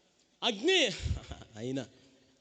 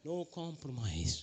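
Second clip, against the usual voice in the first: slow firm refusal; 7 semitones lower, 10 dB quieter, much slower than usual. Acted.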